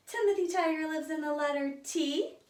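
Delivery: normal talking voice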